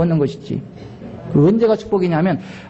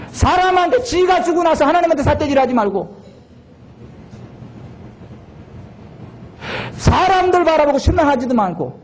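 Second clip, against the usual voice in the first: preaching at full force; 9 semitones higher than usual. Acted. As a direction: preach it with fire.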